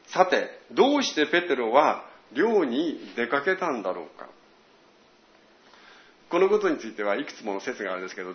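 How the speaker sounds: usual speaking voice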